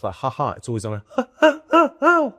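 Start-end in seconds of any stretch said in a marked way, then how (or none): 0.2-0.5 short normal laugh on the exhale; plain ha ha breathed out. Shown as a demonstration. Demonstrating a normal laugh before the weird one, easy exhaled chuckle. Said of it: "laughing on an out breath". 1.1-2.3 high, loud laugh sucked in on the inhale; gasping inward ha ha ha ha, slow; weird innie laugh. Shown as a demonstration. Showing the host his strange laugh, loud high inhaled cackle. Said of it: "it's a weird innie laugh".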